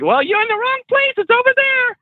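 very high-pitched cartoonish woman's voice; put-on piercingly high character voice; crazy homeless lady. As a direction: squeal it like an eccentric street lady. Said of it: "I'm following the crazy lady".

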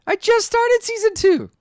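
high, loud, drawn-out mock wail; spoiler outrage. Co-host only one season in, mock-outraged yell. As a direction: shout it in exaggerated protest.

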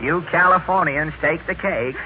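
usual speaking voice

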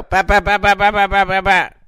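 quick high tapping noises; pecking sounds. Retold or imitated. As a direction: imitate the bird pecking the window. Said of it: "pecking sound imitation".

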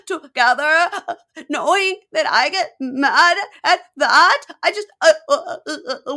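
hammed-up sobbing, high and loud; bawling. Girlfriend crying in hotel lobby, bawling. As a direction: wail slowly through heaving mock sobs. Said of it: "she is bawling, crying".

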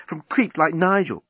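carried away with admiration; Nettie's voice. Acted. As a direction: friend praising Dan, breathless and eager. Nettie gushing on.

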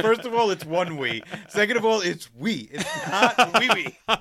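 a hint of an exasperated daughter setting dad straight; mock correcting daughter. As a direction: lecture him like an exasperated teen. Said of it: "she's like".

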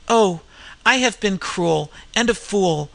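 ordinary delivery

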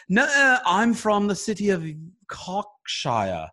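mock British accent, high and loud; horrible British accent. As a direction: overdo a posh British voice. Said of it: "do a horrible impersonation".

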